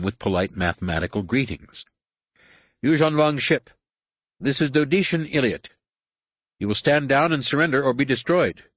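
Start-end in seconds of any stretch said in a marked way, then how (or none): 2.8-8.6 high-pitched, formal character voice; stern alien hail, pitched high; alien commander's ultimatum. Acted. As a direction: deliver an official warning in a raised pitch. Voices the Given giving ultimatum.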